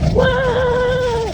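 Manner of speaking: sudden high yelp of surprise; startled whoa. Real, not acted. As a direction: caught off guard by a jolt. Bike jolts down steep hill.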